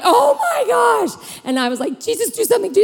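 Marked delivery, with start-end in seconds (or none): 0.0-1.4 long high mock wail; hammed-up slow, high-pitched cry; panicking. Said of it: "that's when we all started panicking". 2.0-2.8 high pleading gabble; frantic plea, pitched up; panicking. Acted. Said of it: "we all started panicking".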